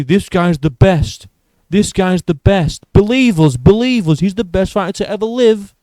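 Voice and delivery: loud, high parody of company hype; mock promotional hype. YouTuber parodying UFC spoon-feeding fans, loud mock hype. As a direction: oversell it loudly, mimicking a pushy promoter. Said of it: "I'm not just getting s-, spoon-fed".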